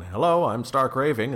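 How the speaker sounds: high, loud announcing character voice; detective answering the phone. Acted. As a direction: play a hammed-up detective introducing himself. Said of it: "Who answers the phone".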